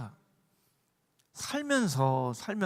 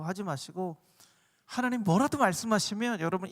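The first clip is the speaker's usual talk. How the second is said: ordinary delivery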